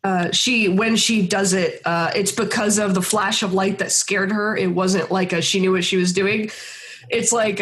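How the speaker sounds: ordinary talk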